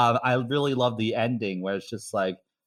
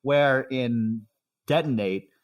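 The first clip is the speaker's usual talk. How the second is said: ordinary talk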